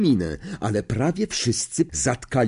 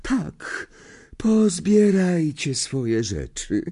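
high, slow, dreamy seer voice; misty voice; 8 semitones higher, much slower than usual. A narrator's reading. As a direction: end the lesson as if in a mist. Trelawney dismisses class.

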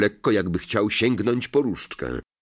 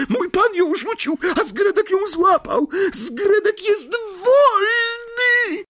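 elf freed. Acted. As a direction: announce freedom through tears. Elf realizes he is free.

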